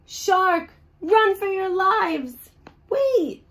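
high panicked cry to flee; panicked shout. Acted. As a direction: scream at everyone to run.

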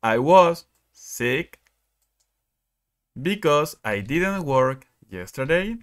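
slow, clear example sentence read to the class; slow model reading. Shown as a demonstration. Teacher reading an example sentence to students, slowly. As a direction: read each word slowly for learners. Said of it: "Dice el primero".

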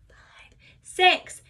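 breathy whispered count. Shown as a demonstration. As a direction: whisper it while squatting. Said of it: "I'm gonna use a whisper voice".

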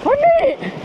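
high-pitched relieved yell; triumphant shout. Real, not acted. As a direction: call out that you survived the crossing. Calls out after crossing rocks.

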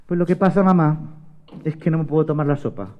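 put-on hushed little girl's confession; sheepish reveal. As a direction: confess it quietly, sinking in pitch. María reveals she finished it.

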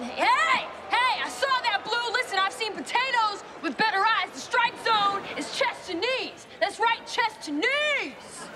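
hey! shouted high and loud, heckling; yelling at the umpire. Acted. At the ballgame, heckling the umpire, loud high angry yelling. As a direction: bellow at the ump, drawing out chest to knees slowly. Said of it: "got a little carried away".

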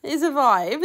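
laughing. Acted. Repeated catchphrase, laughing.

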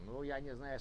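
ordinary talk, nothing marked

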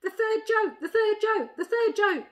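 high, loud excited squawking voice; greatly excited jackdaw. A narrator's reading. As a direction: cry it out like an overexcited bird. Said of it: "said the d-, jackdaw in great excitement".

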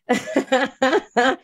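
high-pitched laugh close to tears; high, teary burst of laughter; tearful laugh. Real, not acted. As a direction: laugh while tearing up. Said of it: "I'm tearing up right now".

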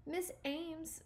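high, soft, upset tremble; trembling voice. Acted. Stuart to Harriet over his ruined canoe, trembling voice. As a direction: say it small and trembling. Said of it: "said Stuart in a trembling voice".

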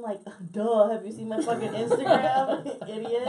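loud, laughing scoff; loud laughing comeback at an insulting comedian; laughing retort. Real, not acted. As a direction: laugh it out, mocking him.

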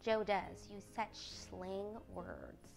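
slow, soft, prim disapproval; hushed, disdainful little sister; reproving. A narrator's reading. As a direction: draw it out quietly in reproof. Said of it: "with a reproving look".

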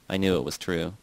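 plain reading voice

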